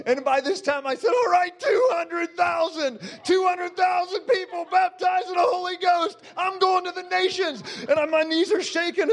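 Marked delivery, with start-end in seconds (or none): 1.1-7.6 shouted vow. Retold or imitated. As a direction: proclaim it to the crowd.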